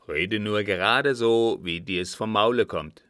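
loud, forceful marshal's permission; blunt command. A narrator's reading. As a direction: boom it out as a blunt order. Marshal orders frank speech. (laute, derbe Aufforderung)

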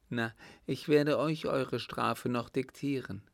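hammed-up older lady's teasing rebuke; amused countess. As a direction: scold the youngsters with amused affection. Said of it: "brach sie in ein herzliches Lachen aus".

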